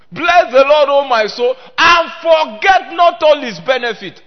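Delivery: a preacher booming scripture from the pulpit; full-force preaching. Acted. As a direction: thunder the psalm out to the congregation.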